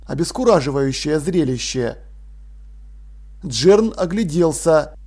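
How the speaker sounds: plain reading voice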